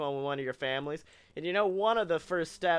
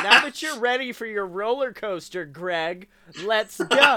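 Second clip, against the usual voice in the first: loud, higher-pitched mock hype; hyped-up send-off; 3 semitones higher, 6 dB louder than usual. Ride operator launching sobered-up Greg onto his roller coaster, mock hype. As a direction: build to a big shouted launch.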